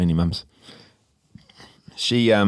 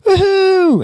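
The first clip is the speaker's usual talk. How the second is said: very high, loud mock woohoo; high mocking whoop; mimicking kid's whoop; 6 dB louder than usual. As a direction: imitate a squeal in falsetto. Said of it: "one of the kids goes".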